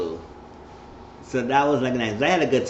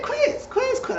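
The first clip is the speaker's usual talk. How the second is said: very high-pitched repeated calling; squeaky mock nagging; slower than usual. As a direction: imitate a woman pestering for attention. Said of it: "Nagging Chris".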